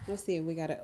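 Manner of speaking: usual speaking voice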